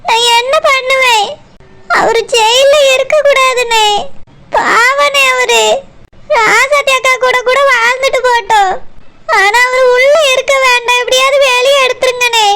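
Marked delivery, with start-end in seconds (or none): 0.1-5.6 pleading through sobs, slowing down; crying. Acted. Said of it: "நீ அழாத". 11.0-12.5 crying plea. Acted. Wife begs brother through tears.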